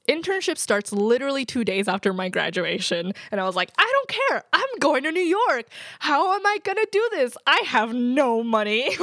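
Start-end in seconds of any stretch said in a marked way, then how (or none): 3.8-9.0 much higher, louder, breathless excitement; freaking out. Retold or imitated. Grad telling listeners about her giddy thrill, much higher and louder. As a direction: squeal it like a thrilled graduate. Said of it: "I freak out".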